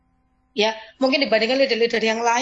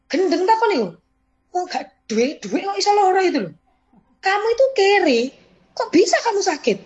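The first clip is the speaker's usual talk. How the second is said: omelan keras bernada tinggi; bos memarahi; 8 semitones higher, 5 dB louder than usual. Retold or imitated. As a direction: bentak dia seperti bos galak. Leader memarahi, logat Jawa. (bos galak membentak dengan nada tinggi)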